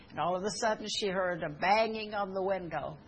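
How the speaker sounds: ordinary talk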